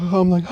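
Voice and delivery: sharp high gasps; hyperventilating gasps. Retold or imitated. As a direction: gasp for air in panic. I start hyperventilating.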